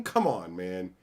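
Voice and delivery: usual speaking voice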